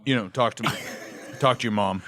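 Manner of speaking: hesitant dad, lightly voiced, with long pauses; halting imitation of a father giving the talk; dad's talk. Son acting out his dad's talk, halting mock-fatherly delivery. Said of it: "the talk my dad gave me".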